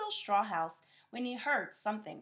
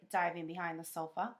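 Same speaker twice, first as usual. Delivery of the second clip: ordinary delivery, nothing marked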